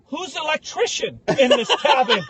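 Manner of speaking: mock outrage. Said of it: "I was like".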